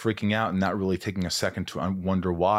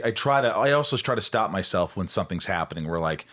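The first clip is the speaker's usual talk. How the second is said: ordinary delivery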